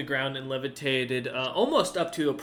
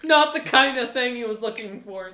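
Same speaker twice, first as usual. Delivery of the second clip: laughing narration; 9 semitones higher, 7 dB louder than usual. Real, not acted.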